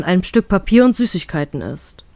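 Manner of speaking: usual speaking voice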